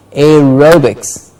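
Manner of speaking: "Aerobics" slowly, air-ROH-biks; slow model of "Aerobics", beat on "ro"; stress on RO. Shown as a demonstration. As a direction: draw out "ro" in "Aerobics". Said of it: "Ro gets the stress".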